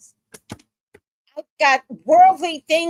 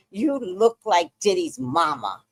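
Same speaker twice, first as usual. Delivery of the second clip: ordinary talk, nothing marked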